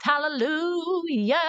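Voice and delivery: high, slow, drawn-out hallelujah; celebratory exclamation. Real, not acted. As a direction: stretch it out high like a praise shout. Celebrates the good news she gives.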